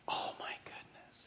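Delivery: hushed, voiceless whisper; whispered aside. Real, not acted. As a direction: let it out as a barely audible breath. Preacher reacts to Paul's sarcasm.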